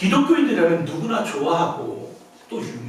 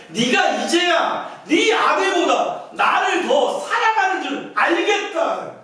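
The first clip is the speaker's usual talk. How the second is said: emphatic divine declaration; 9 semitones higher, much slower than usual. Acted. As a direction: stretch each word out and call it out for God. God's verdict, preached emphatically.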